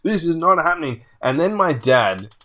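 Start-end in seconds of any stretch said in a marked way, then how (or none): 0.0-1.0 high-pitched mock denial; raised, disbelieving protest; retold meltdown. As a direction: imitate a man going nuts over losing. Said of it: "he's just gone completely nuts".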